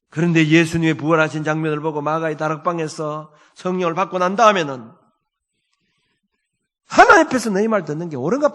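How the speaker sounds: loud, high, ringing exhortation; full-force preaching. Acted. Pastor rousing his congregation to stand tall, preaching full force. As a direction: preach it out boldly to the whole congregation.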